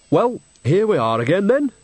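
lightly voiced cheeky old woman; a hint of a sly village granny; Nanny Ogg.

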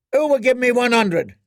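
high, loud auction cry; auctioneer cry. A narrator's reading. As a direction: cry out for bids. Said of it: "cried the auctioneer".

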